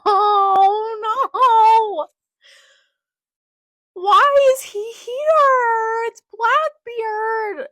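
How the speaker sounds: very high, loud, drawn-out wail; anguished scream. Real, not acted. Fan seeing Blackbeard turn up, anguished scream. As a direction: scream each word out long in dismay.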